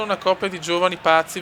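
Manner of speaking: ordinary talk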